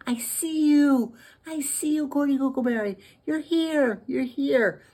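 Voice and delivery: excited discovery. Acted. Taylor spots Gordy in the vent, excited cry. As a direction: sing out in amazed joy, high.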